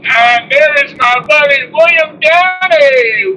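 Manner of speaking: drawn-out cheerful call of a name; excited greeting. Real, not acted. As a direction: call out to a friend, slow and high. Greeting a viewer in chat.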